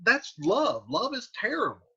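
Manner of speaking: high, worked-up modern voice; exasperated paraphrase. Retold or imitated. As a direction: throw up your hands at love. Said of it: "he's like".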